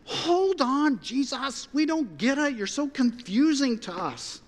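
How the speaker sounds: confused Philip. Retold or imitated. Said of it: "Philip, goes".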